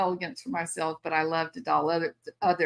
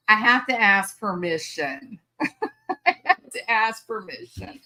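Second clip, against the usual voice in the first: said through laughter, the repeat slower; laughing; 2 semitones higher than usual. Real, not acted. Grandma joking about asking her daughter, laughing. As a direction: giggle while you say it. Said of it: "laughing through it".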